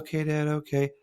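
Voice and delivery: lightly voiced eye-rolling okay; kids placating dad. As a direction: brush dad off like the kids would. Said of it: "they'll be like".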